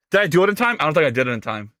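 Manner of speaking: usual speaking voice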